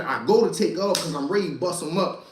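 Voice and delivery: normal talking voice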